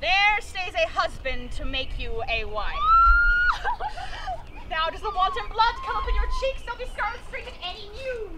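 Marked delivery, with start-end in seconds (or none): 2.8-4.6 high squealing laugh; shrieking laugh. Acted. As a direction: let out a high, delighted shriek. Nurse teases about husband.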